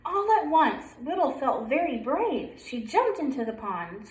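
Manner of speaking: usual speaking voice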